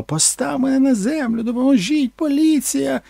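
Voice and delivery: high screaming cry for help; mock screaming actress. Actress screaming while carried, high mock scream. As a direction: shriek it like a terrified starlet. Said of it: "хоча вона кричала".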